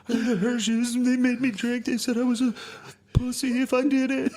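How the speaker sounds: put-on very high bawling kid voice; mock tearful whine. Drunk son tattling on kids to mom, mock tearful whine. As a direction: wail out the excuse, comically pitiful.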